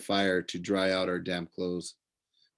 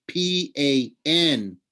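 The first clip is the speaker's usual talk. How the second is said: slow spelling; 6 semitones higher, 4 dB louder, much slower than usual. A narrator's reading. Grover spelling out the name.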